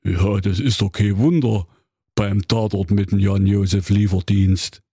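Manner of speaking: Grimm brother as a call-centre recording; a polite hotline greeting from Jakob Grimm. Acted. Grimm answers. (Jakob Grimm als Hotline-Ansage)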